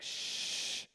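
long, soft "shh"; drawn-out quieting hiss; hushing shush. Retold or imitated. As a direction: imitate a dad urgently hushing his kid. Father hushes son on plane.

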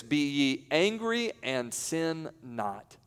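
slow reading. Acted. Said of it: "verse read slowly".

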